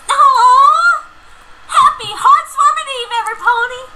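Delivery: high loud cheerful cry; jovial shout. Acted. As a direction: shout the greeting with joy. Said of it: "At her jovial shout".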